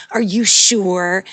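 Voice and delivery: loud, slow doubting "are you sure"; skeptical loud question. Retold or imitated. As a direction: drag it out, disbelieving. Mocking quote, loud and slow.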